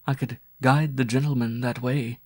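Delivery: droning. A narrator's reading. Woman offering to guide Waldo back, droning. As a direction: drone it out as if half-asleep. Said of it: "she droned".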